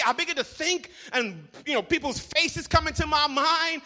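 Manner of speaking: ordinary talk, nothing marked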